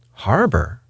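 mildly astonished landlord; a touch of disbelief; astonished cry. A narrator's reading. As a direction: echo it as a startled question, pitch jumping up and falling back. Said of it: "cried landlord".

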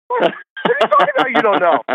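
high, rapid disbelief dropping back down; retold incredulity. Colleague learning his friend didn't know the guy, high mock incredulity. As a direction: sputter in mock astonishment.

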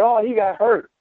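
a hint of fake sympathy for the injured guy; mock concerned bystander. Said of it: "people are gonna be concerned".

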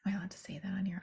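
unvoiced, secretive whisper; whispered aside. Real, not acted. Vlogger checking if swearing is allowed, whispering.

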